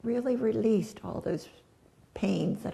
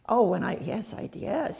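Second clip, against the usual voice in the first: ordinary talk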